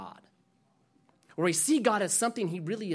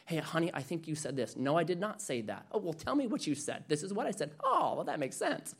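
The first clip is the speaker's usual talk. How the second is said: quieter, gentle back-and-forth between spouses; soft acted couple exchange; 6 dB quieter than usual. Pastor voicing husband and wife calmly talking it out. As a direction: play both spouses calmly, hushed.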